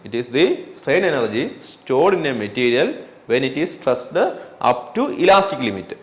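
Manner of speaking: very slow, word by word; slow dictation. Shown as a demonstration. Teacher dictating a definition to students, very slow. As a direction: spell out the definition for notes. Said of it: "dictating definition".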